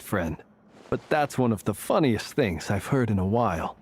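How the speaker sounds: ordinary talk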